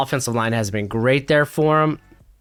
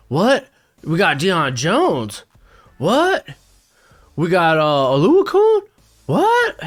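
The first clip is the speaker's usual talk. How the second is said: incredulous; 3 semitones higher, slower than usual. Real, not acted. Disbelief at the pick.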